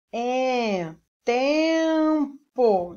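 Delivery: "tempo" very slowly, holding the nasal "tem"; sounding out syllables. Shown as a demonstration. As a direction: stretch "tempo" to show its nasal vowel. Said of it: "note que você pronuncia". ("tempo" bem esticado, som nasal demorado)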